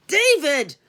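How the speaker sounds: sharp furious shout of his name; yelled. A narrator's reading. Operator yells at the lewd caller to stop, furious shout. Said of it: "I yelled".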